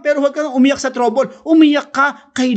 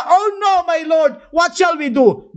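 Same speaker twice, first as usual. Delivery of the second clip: much higher, frightened cry; panicked servant; 8 semitones higher than usual. Acted. Servant panics at the army and cries to the prophet, high and frightened. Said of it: "The servant asked".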